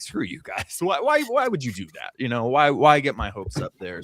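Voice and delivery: exasperated retold complaint, breaking into a laugh; half-laughing, play up the annoyed pleading; mock pissed-off. Fan protesting to game makers over dashed hopes, mock pissed-off. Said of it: "instantly got pissed off and was just like".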